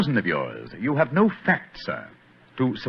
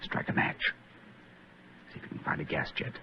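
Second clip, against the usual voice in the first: whisper; 13 dB quieter than usual. Acted. Sneaking into dark cellar.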